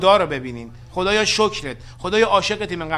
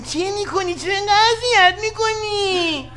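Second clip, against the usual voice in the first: mock whine; 12 semitones higher, 3 dB louder, slower than usual. Mock complaint to laughing wife.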